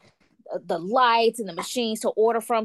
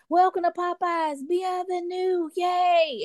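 cheering welcome; 11 semitones higher, slower than usual. Retold or imitated. Staff clapping and cheering her in.

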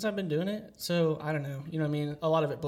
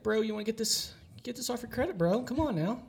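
higher, coaxing bro voice; pushy bro caller; 7 semitones higher than usual. Acted. Debt collector coaxing a debtor to pay, higher pushy bro voice. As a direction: sweet-talk the debtor into paying, pitched up.

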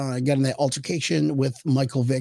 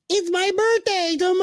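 high mock quote. Quoting her at first meeting.

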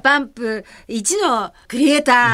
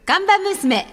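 show title call; 9 semitones higher than usual. Real, not acted.

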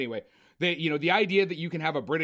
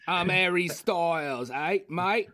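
slow, put-on cockney British; slow hammed-up British accent; mock British accent; slower than usual. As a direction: drawl it out like a mock Brit. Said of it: "British accent".

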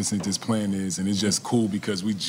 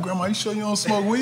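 higher, laughing through the question; teasing laugh; 11 semitones higher than usual. Retold or imitated. Grandson teasing grandma about smoking, laughing. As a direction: tease grandma while cracking up. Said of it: "I'm like".

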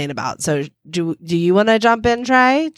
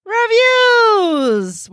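excited shout. Acted. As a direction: belt it out like a game show. Hosts' intro bit, shouted.

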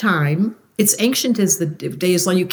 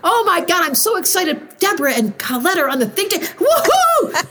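higher, squealing with laughter, overjoyed; excited fire type; 9 semitones higher than usual. Pretend. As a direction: bubble over like an overexcited party friend. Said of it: "And then fire".

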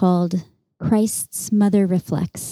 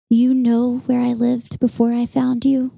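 put-on higher, measured voice for a child's question; 5 semitones higher, 6 dB louder than usual. A narrator's reading. Boy asks his mother while watching fireflies, lightly voiced child's question. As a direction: lightly lift the pitch, evenly, as a child asking. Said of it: "he asked once".

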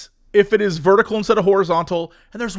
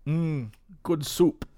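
good soup meme; 5 semitones lower, 9 dB quieter, much slower than usual. Retold or imitated. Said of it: "that line where it goes".

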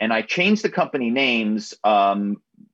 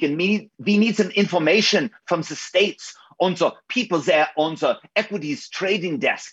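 higher-pitched German-accented caller on an urgent phone con; 7 semitones higher than usual. Shown as a demonstration. Spy on the phone posing as a Frankfurt manager, urgent German accent. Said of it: "give us, like, a little tease of one of your best ruses".

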